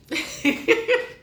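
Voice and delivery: high breathy giggle after a burp; embarrassed laugh. Real, not acted. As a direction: laugh it off, caught out. Laughs after burping on camera.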